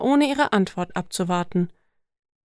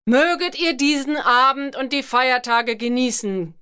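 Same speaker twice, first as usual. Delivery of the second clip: booming thunder voice; 4 semitones higher than usual. Acted. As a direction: proclaim grandly to the whole hall. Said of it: "donnerte die Stimme des Dieners".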